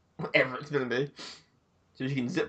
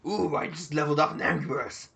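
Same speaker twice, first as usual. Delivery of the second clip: usual speaking voice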